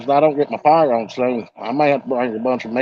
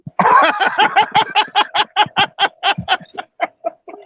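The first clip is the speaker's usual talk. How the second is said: high laugh. Real, not acted. Laughing at lisp joke.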